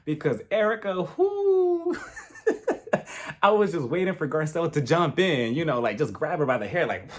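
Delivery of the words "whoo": shrieking whoo and laughter; high whoo into laugh. Real, not acted. Vlogger reacting to Erica, high whoo and laugh. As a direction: let out a high whoo then crack up.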